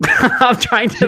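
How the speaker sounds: breaking into laughter; giggling mid-sentence; laughing. Real, not acted. Laughing while reading ad.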